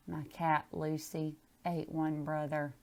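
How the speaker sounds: ordinary delivery, nothing marked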